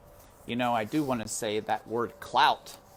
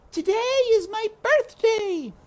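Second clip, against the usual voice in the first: put-on high girl's voice, louder; eager little girl calling out; Meg calling out; 10 dB louder than usual. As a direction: call across to the royals as a child. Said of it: "Meg called out".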